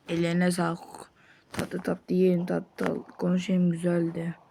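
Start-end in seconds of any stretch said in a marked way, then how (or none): none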